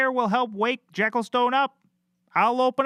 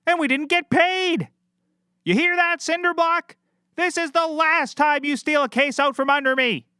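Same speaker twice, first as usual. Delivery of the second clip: mock outrage; 6 semitones higher than usual. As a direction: rant at the cinder block. Detective rants at cinder block.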